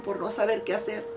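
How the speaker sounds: usual speaking voice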